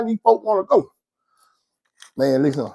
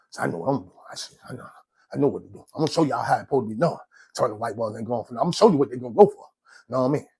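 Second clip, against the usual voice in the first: quiet scheming; 7 semitones lower, 9 dB quieter, much faster than usual. Real, not acted.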